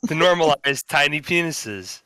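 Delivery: slow, deliberate newscaster read; news anchor read. Acted. Reads a mock news story.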